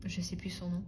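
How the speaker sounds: under her breath, whispering; whispered aside. Real, not acted. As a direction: half-whisper to herself. Mutters while searching for a name.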